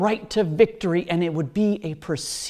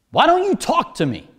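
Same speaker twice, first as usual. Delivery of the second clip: higher, louder demand for an answer; 8 semitones higher, 5 dB louder than usual. A narrator's reading. Pilate pressing silent Jesus for an answer, demanding. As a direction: read it as an insistent, forceful challenge. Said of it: "Pilate demanded".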